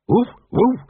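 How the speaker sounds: high, spoken dog's bark; lazily faked woofing in a character voice; talking dog. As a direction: say the barks as words, pitched high. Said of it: "said a voice behind them".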